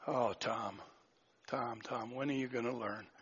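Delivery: low, hushed, weary reproach; burdened. Acted. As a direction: sigh it softly, as if burdened by a wayward follower. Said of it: "Has he ever been burdened by me?".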